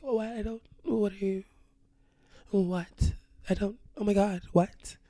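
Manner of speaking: mock-innocent confusion; innocent act. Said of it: "she kept doing this innocent act".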